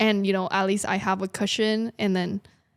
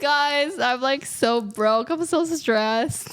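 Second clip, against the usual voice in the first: hammed-up tearful whine, higher and drawn out; mock crying plea; 4 semitones higher, much slower than usual. Streamer begging viewers for money, mock crying. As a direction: plead slowly through a laugh, pretend crying. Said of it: "I cried all the time".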